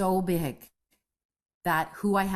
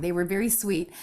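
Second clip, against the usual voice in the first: ordinary talk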